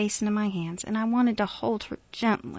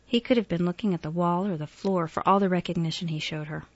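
ordinary delivery

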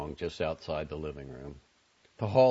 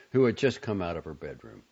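plain reading voice

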